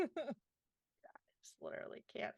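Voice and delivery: whispered, can't-even aside; exasperated whisper. Real, not acted. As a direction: breathe it out, defeated. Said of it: "exasperated aside".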